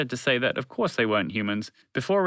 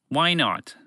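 higher, challenging retort; mildly confrontational; combative; 6 semitones higher, 3 dB louder than usual. Acted. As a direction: counter as if ready for a fight. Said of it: "Fred countered, ready for a fight".

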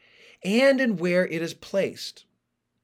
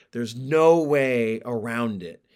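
normal talking voice

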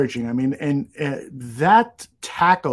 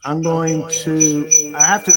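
echoing; 6 dB louder than usual. Real, not acted. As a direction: echo on the line. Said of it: "Now you're echoing big time".

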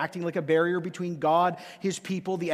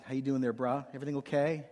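mock calm; 5 semitones lower, 7 dB quieter, faster than usual. Calm dad facing son's tantrum.

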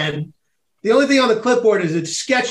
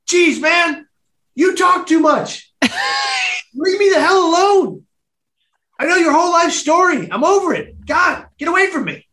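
put-on annoyed teen kid; 10 semitones higher than usual. Fed-up kid telling the chatty volunteer to leave him alone. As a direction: snap at him like a fed-up teenager.

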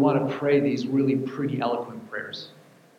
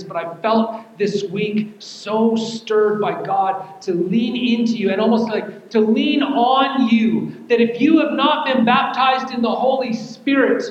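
higher, louder, half-sung preaching; preaching at full force; 9 semitones higher, 6 dB louder than usual. Acted. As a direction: preach it out, building.

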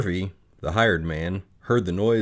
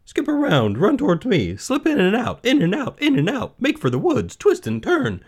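high, loud, urgent squawking; bossy high-pitched goose voice; goose giving orders; 6 dB louder than usual. Acted. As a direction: fire off commands as a frantic goose. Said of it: "began to give orders".

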